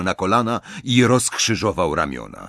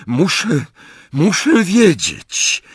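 halting, then higher and louder; urgent resolve; 9 semitones higher, 5 dB louder, slower than usual. A narrator's reading. Bellatrix talks herself into finding out, urgent. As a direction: build from hesitation to drawn-out insistence.

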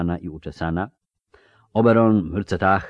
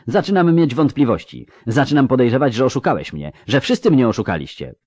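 higher, louder, voice rising in suspicion; indignant accusation; 7 semitones higher, 5 dB louder than usual. A narrator's reading. Ciri accusing Avallac'h of lying to her, indignant and raised. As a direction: throw the accusation at him.

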